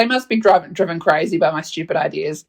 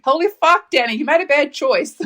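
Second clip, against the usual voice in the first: mock shock; 7 semitones higher than usual. Friend sees the ex and reacts, high mock shock with laughter.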